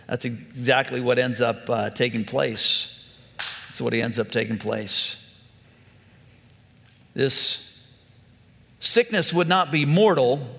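ordinary delivery

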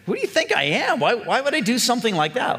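high, shocked protest; indignant disbelief. Retold or imitated. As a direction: recoil in disbelief. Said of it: "is like".

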